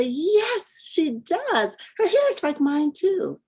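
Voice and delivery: put-on very high, eager child voice; delighted child. Diane answering her classmate about the book, delighted. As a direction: chime in happily as a child agreeing.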